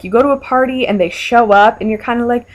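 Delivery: ordinary delivery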